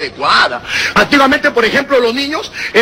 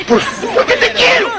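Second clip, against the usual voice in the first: high-pitched, stammering fond answer; doting father; 12 semitones higher than usual. Acted. Father answering why he brought a sweet, pitched-up stammer.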